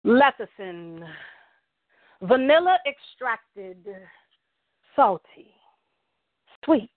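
slow, paced poetry recital with long pauses; spoken word poem. Acted. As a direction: savor each word like a slam poet on stage. S1 recites her love poem.

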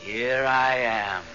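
ghostly voice. Acted. Ghostly voice at the window.

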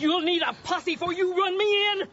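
defiant retort. Acted. Ma defying the deputy, loud and defiant. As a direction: throw the challenge right back.

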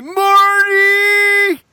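high frantic shout of Marty; Doc Brown yell. Retold or imitated. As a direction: scream it like a panicked scientist. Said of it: "Do you remember this in the movie?".

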